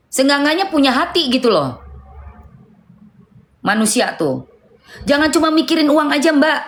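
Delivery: sharp lecture pitched well up. Real, not acted. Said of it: "gue naikin, eh, apa, intonasi suara".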